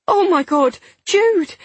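high, loud gasp of alarm; horrified cry. A narrator's reading. Woman seeing her bleeding partner, horrified cry. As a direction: cry out his name in horror.

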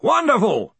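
high, hearty shout. Acted. Arch-Chancellor cutting off bad news, shouting. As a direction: shout over the other speaker. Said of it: "shouted Ridcully".

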